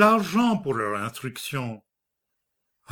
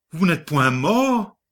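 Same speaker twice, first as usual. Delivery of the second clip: repeat, pitched higher, mort falling; stunned repeat climbing higher on mort; second, higher take of "Vous n'êtes point mort?"; stammered shock, higher; 4 semitones higher, 4 dB louder than usual. A narrator's reading. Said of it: "Il bégaya".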